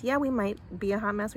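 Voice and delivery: usual speaking voice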